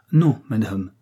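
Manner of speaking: ordinary delivery, nothing marked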